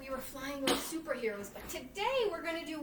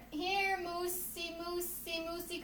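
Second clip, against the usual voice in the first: slow, softer coaxing call; 4 semitones higher, 6 dB quieter, slower than usual. Shown as a demonstration. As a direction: lure the moose from afar. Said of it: "with a carrot".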